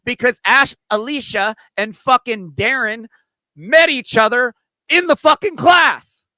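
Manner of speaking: heated, loud and rising rant; angry yelling, pitch climbing high. Real, not acted. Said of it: "if I just stop yelling".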